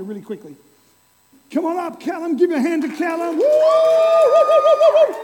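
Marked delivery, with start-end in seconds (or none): 1.5-5.2 shouted high woos, whipping up the room; rally the crowd to cheer Callum; hype cheer. Real, not acted. Pastor hypes the congregation to applaud the volunteer, shouting woo.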